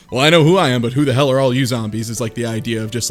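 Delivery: ordinary delivery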